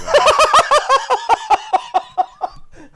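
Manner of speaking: sustained high shrieking laughter; loud, high, real fit of laughter; long loud laugh. Real, not acted. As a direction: burst out laughing at the claim. Laughing at rival's prediction.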